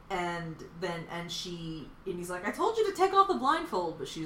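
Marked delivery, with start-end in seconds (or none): 2.5-4.0 high, louder mock exasperation; retold scolding in a raised pitch; mock-exasperated squawk of a quote. Retelling the son scolding mom.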